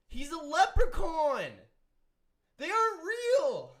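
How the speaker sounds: loud, slow and high, in disbelief; exasperated outburst. Real, not acted. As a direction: spell it out loudly like it's obvious. Exasperated rant about leprechaun mascot.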